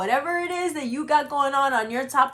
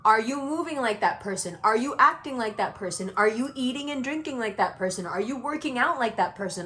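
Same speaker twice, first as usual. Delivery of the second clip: normal talking voice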